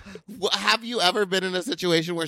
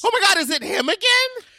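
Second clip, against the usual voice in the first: high-pitched, excited astonishment; real shocked disbelief; 4 dB louder than usual. Real, not acted. Cohost realizes it is the same man, high-pitched shock. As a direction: shoot way up in pitch, amazed. Cohost reacts to the spanking story.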